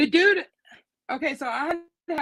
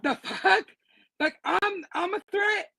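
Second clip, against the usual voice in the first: high mocking disbelief; sarcastic scoff; 5 semitones higher than usual. To the guard claiming she'd attack, a high sarcastic scoff. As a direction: scoff at his excuse, voice up high.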